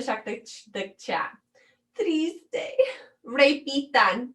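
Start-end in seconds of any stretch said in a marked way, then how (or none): 2.0-2.7 high, teary little "Triste". Shown as a demonstration. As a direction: let the voice crack with tears. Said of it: "made myself cry". (triste, casi llorando)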